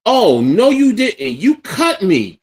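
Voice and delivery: loud, high, incredulous outburst; outraged shout. Retold or imitated. Bleeding man confronts his brother, outraged shout. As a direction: blow up in retold outrage, last words drawn out. Retelling outrage on finding he bled.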